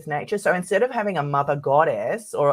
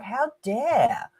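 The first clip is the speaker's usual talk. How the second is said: outraged humans; 8 semitones higher than usual. Retold or imitated. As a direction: sneer it like an offended superior species. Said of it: "They're like".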